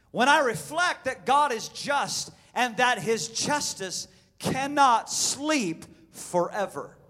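slow, swelling, loud proclamation; solemn reading. Shown as a demonstration. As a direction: read it slowly, rising to a cry. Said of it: "let me read his words to you".

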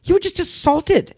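high and slowed, stressing each word; indignant cry. Retold or imitated. Telling friends at the pool party, a high shocked protest. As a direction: insist on it, shocked. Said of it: "And I was like".

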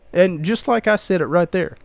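usual speaking voice

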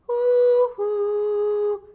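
high, loud owl call; hoo-hoo like an owl; owl hoot. A narrator's reading. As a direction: hoot out to the chick. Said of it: "diye seslendi Tuti".

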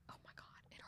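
soft aching whisper. Real, not acted. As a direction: breathe it like it hurts. Gushing over a sad song.

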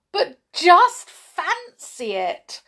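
high, loud, slow cry of joy; overflowing with delight; high loud slow joyful cry. A narrator's reading. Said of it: "cried Jump in delight".

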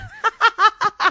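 a sudden high burst of laughter; big laugh. Real, not acted. Burst of laughter at own story.

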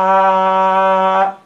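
long, steady oral "A"; non-nasal sound. Shown as a demonstration. Teacher tests pinching the nose on a non-nasal "A". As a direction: hold "A" open on one note, nothing through the nose. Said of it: "no es un sonido nasal".

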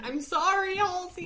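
Midwest accent. Shown as a demonstration. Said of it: "that's my Midwest accent".

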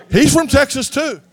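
usual speaking voice

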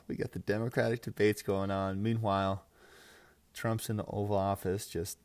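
normal talking voice

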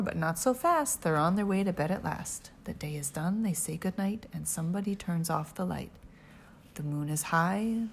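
usual speaking voice

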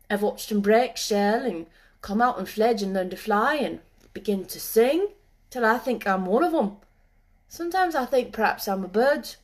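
put-on rustic boy, animated and rambling; moor boy. A narrator's reading. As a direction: muse aloud like a moor lad at one with the animals.

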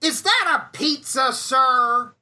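high, loud mock Heyman taunt; Paul Heyman voice. Paul Heyman taunting the pizza guy, high and loud. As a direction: lay on the smug menace of the promoter. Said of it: "Paul ... goes".

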